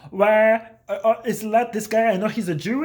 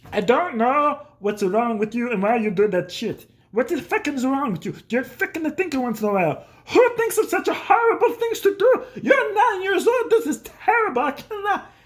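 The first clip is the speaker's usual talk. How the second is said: imitated dad; 5 semitones higher than usual. Dad scolding his nine-year-old, mock horrified. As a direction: build from baffled grumble to high mock outrage. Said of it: "consistently heard from my dad was like".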